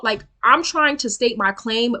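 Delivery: normal talking voice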